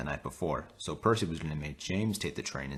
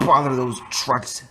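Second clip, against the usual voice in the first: loud, high, slow angry shout; angry outburst; 8 semitones higher, 8 dB louder, slower than usual. Acted. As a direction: bellow it out, slow and cross. James fumes after crash.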